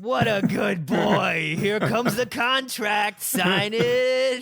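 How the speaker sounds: put-on slow, high cooing baby talk. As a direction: coo and push the contract. Cooing client into signing.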